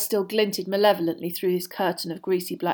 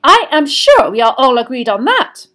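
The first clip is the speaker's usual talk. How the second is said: high, loud, insistent; forceful and brisk authority; sharp professor; 6 semitones higher, 13 dB louder than usual. Acted. Professor insisting on the age line, sharply.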